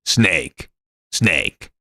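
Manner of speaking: low, gravelly soldier growl; deep Snake voice. Shown as a demonstration. Streamer tries Snake for chat, deep growl. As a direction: push the voice way down like Solid Snake. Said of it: "He sounds like this".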